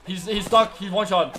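loud, urgent callout to the squad; excited callout. Real, not acted. Gamer yells to his squad that the enemy is one shot, excited and loud. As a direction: shout that the enemy is nearly dead. Teammate calls a weak enemy.

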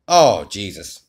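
ordinary delivery

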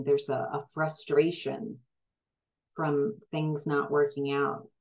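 normal talking voice

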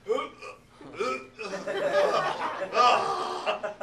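loud laughing uh uh gag; disgusted gagging. Retold or imitated. Teacher acting the class gagging at the poo-drinking, loud and laughing. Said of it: "you're just like".

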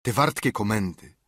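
usual speaking voice

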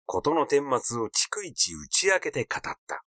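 ordinary delivery, nothing marked

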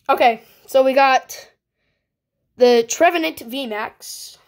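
ordinary talk, nothing marked

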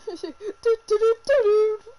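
high, loud sung "te-te-de-te-ri"; loud "te-te-de" jingle. Pretend. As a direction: belt out a wordless little fanfare. Wordless hummed jingle after joke.

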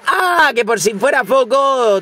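startled shout of disbelief; surprised yelp. Real, not acted. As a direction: jolt up in pitch at the nasty surprise. Opponent plays rocket unexpectedly.

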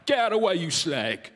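rough Southern English brush-off; blunt, abusive Southerner's accent; imitated Southern English accent, curt insult. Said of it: "in the South, we say things like".

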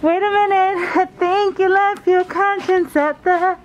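teasing. Real, not acted. Teasing friend in store.

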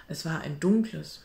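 plain reading voice

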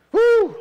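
loud high whoop. Real, not acted. Preacher whooping to fire up the congregation. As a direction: let out a joyful whoop.

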